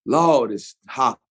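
a light open-mouthed Mississippi drawl; mild Mississippi drawl, mouth wide open in the heat. Shown as a demonstration. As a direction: let the Mississippi vowels hang open and lazy. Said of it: "the mouth is more open".

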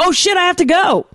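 high-pitched pretend urgency; mock alarm. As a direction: blurt it out in sudden mock panic. Acts out abruptly having to leave.

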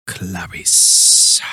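low, whispery, drawn-out "Clarice"; Lecter imitation. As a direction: hiss the name slowly like Lecter. Creepy echo of Lecter saying Clarice.

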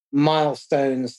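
ordinary talk

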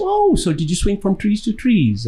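lightly hammed-up naive question; mock ignorant question. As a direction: parody a clueless asker. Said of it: "And I'd say".

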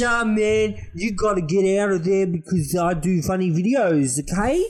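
put-on chirpy Geordie accent YouTuber. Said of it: "Actually sounds like friendly Geordie".

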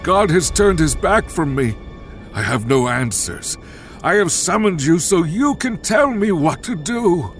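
high, forceful desperate outburst; desperate, frantic. Acted. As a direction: plead frantically for direction. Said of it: "He was desperate, afraid, and frantic".

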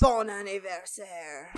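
slow, hammed-up French accent; mock French accent. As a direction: draw it out in playful French. Quoting Family Guy Zidane birthday bit.

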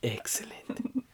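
slow evil whisper; sneering panto villain. Pretend. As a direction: hiss it like a scheming villain. Villain reply after cackle.

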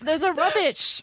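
high, laughing fake Cockney; bad British accent. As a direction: toss it off in mock London slang. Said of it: "bad attempts at British accents".

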